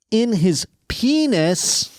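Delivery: slow, high-pitched mock horror; mock shock. Host reading an alarming headline, mock shocked. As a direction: stretch it out in hammed-up disbelief.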